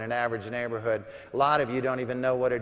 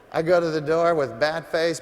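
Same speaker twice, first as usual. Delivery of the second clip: usual speaking voice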